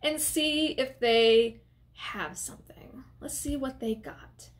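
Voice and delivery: slow conspiratorial whisper. Retold or imitated. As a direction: hush it like a secret plan. Whispered retold suggestion.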